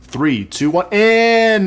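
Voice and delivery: countdown. Real, not acted. Host starting a rapid-fire round, drawn-out countdown. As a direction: count in, stretching the last word high and loud.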